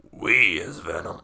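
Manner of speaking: slow, low monster voice; Venom. Acted. Venom crushes the villain one-handed, low slow monster voice. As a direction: attempt a slow creature growl, not quite there. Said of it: "My Venom voice is gone".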